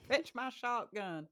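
hammed-up rural Southern accent; mock Southern accent. Co-host echoing host's mimicry, mock Southern accent. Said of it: "those are easier to mimic".

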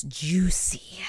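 whispered conspiratorially to friends; whispering the word to viewers about the juicy ending; teasing whisper. Real, not acted. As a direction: breathe the word like a secret. Teasing recap of juicy episode.

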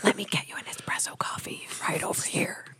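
mock coffee house rap in a whisper. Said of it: "coffee house rap from the [name]".

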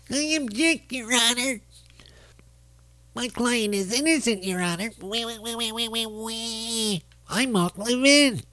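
imagining the host pleading in court, high and nasal; mock Levin in court. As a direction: squeak out objections through a pinched nose. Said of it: "It hurts to do that voice".

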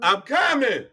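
loud call to the next room; yelling across the room. Real, not acted. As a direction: call out to someone far off. Answering wife from basement.

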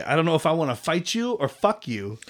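ordinary delivery

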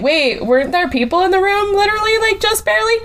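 high, baffled "wait, what?"; mock bewildered old self. Imagines forgetting people in room.

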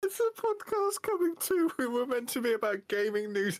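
high, helpless exasperation; exasperated laughing complaint. Real, not acted. Co-host objecting to the cartoon bit, exasperated.

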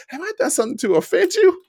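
high, fast, fretful client voice; hammed-up worried client. Client asking trainer if he offended him, high and fretful. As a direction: pitch way up and fret quickly. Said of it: "One of my clients always says that".

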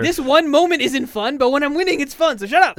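high, full-volume comic outburst; retold yelling. Gamer reenacting his retort to his girlfriend, yelling high. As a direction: yell back in mock outrage. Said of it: "I'm just yelling at my screen".

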